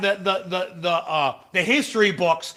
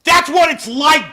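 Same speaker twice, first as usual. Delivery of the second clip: angry rant; 6 semitones higher, 5 dB louder than usual. Real, not acted. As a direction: yell the correction back at the screen. Angrily correcting Vance clip.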